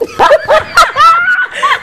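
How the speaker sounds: high, loud laughing shriek. Real, not acted. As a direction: crack up at your own joke.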